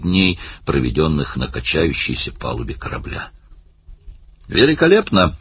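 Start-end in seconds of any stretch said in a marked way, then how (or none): 4.5-5.4 high-pitched exclamation of delight; delighted outburst, pitched well up; excited cry. A narrator's reading. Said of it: "крикнул Барни".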